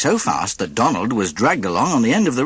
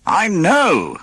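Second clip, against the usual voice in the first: snapping; 10 semitones higher, 3 dB louder than usual. A narrator's reading. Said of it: "snapped Donald".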